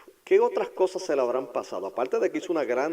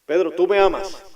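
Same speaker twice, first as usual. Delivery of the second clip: louder, higher insistent ask; pleading question; 7 semitones higher, 12 dB louder than usual. Acted. Jesus asking Peter again, louder and higher. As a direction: press the question harder.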